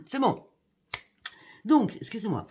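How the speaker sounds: strained voice after swallowing the wrong way; swallowed the wrong way. Real, not acted. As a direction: still half choking, pushing words out. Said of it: "J'ai avalé de travers".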